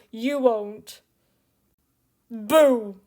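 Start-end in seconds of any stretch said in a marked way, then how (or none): 2.3-3.0 sudden loud spooky boo; startling boo. Pretend. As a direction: jump out and shout boo. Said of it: "Did I scare you?".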